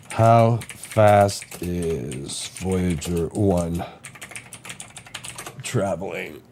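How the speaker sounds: very slow, drawn-out words; absently stretched-out search query, slow; typing aloud. Real, not acted. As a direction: say it word by word as you type.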